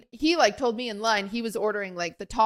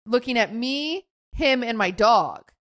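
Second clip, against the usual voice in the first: ordinary delivery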